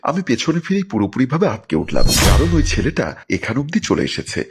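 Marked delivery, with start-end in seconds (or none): none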